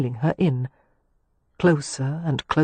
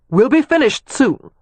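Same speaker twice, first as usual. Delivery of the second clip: louder, a bit higher, calling up to her; calling back; 7 semitones higher, 5 dB louder than usual. A narrator's reading. To the woman at the window, a louder called reply. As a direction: call it across to the window, curt. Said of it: "Leo called back to her".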